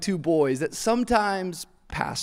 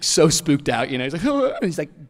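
ordinary talk

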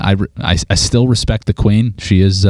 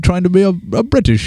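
slightly higher, hammed-up British accent; 9 semitones higher than usual. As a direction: play the British accent for fun. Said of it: "It sounds a little British".

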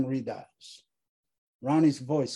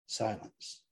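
ordinary talk

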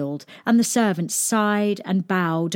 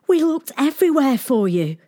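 distraught maid; 7 semitones higher, 4 dB louder than usual. A narrator's reading. Tearful servant pleads.

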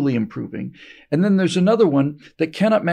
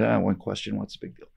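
muttered aside; 5 semitones lower, 7 dB quieter than usual. Retold or imitated. Brezhnev muttering to himself.

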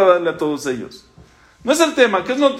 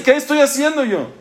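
despairing cry; 8 semitones higher than usual. Acted. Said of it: "Entonces no dice".

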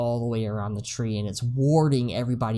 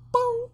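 high squeaky boom sound; mock boom. Describing the troll's death noise, high mock boom. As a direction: squeak out the little explosion. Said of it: "the end noise is like".

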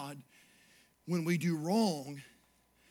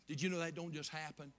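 ordinary talk, nothing marked

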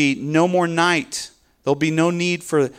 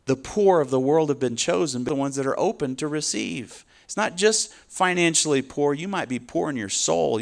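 ordinary talk, nothing marked

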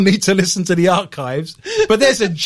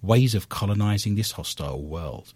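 lower, measured newsreader delivery; news read; 6 semitones lower than usual. Acted. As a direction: read out the science bulletin. Reads a news item.

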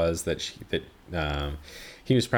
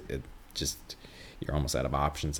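whispered aside; 4 semitones lower, 8 dB quieter than usual. Real, not acted. Muttered aside about options.

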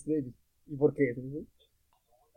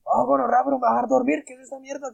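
high, exasperated mock complaint; queja del abuelo; 7 semitones higher, 3 dB louder than usual. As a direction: complain like a granddad kept from sleeping. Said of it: "Luego otra vez llegaba la abuelita". (reclamo agudo e indignado, imitado)

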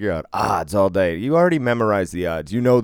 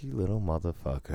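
muttered insult; 9 dB quieter than usual. Retold or imitated. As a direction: say it under your breath. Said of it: "And I was like".

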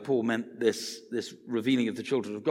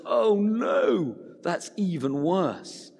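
sudden shout of Oh no, then slowing right down; startled yell easing into a slow groan; alarmed outcry; 6 semitones higher, much slower than usual. Retold or imitated. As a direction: cry out in dismay, then drag the rest out. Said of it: "And he goes".

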